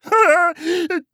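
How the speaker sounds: very high, silly giggle; gleeful frog laugh. A narrator's reading. Frog delighted with life, high gleeful laugh. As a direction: cackle happily in a high frog voice.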